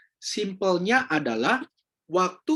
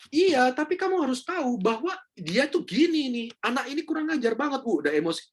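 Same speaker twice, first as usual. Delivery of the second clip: raised-pitch indignant complaint, played up; mock angry client; 8 semitones higher than usual. Fed-up client venting to the counselor, mock indignant and higher. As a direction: act out a fed-up client venting about the kid.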